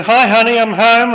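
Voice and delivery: high, loud, beaming husband greeting; cheerful husband. Acted. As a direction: call it out cheerily through the door. Husband arrives home again.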